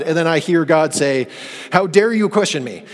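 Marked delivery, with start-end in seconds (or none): 1.7-2.8 higher, indignant how dare you; offended rebuke. Retold or imitated. God taking offense at Job, indignant rebuke. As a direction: snap it back indignantly. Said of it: "I don't think that's God's tone here".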